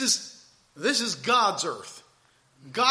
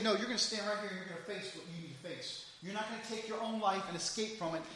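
much softer, fast, firm command as God; quiet stern God; 11 dB quieter, faster than usual. Acted. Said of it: "God's gonna say".

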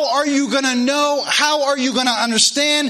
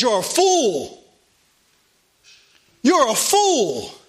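slow stern rebuke; slower than usual. Acted. God rebukes man.

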